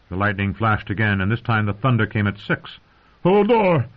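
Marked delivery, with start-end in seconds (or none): 3.2-3.9 loud, high-pitched yell. A narrator's reading. Hodor panicking at the thunderstorm, yelling. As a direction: yell the name full out. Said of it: "Hodor yelled again".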